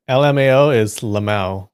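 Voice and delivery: lamau said as luh-MOW, rhyming with now; lamau pronounced. Shown as a demonstration. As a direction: spell L M A O then say lamau. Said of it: "That's how you say it".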